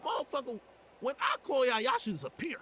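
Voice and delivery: high, heated rant about the cops; mad at the cops. Real, not acted. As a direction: vent hard about the taxes you pay. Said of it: "I'm mad at the cops".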